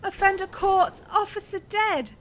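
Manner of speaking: high, loud, alarmed read-out; dismayed outcry. Real, not acted. Streamer reading the fatal mission result, high dismayed outcry. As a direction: call out bad news in dismay.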